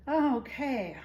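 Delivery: plain reading voice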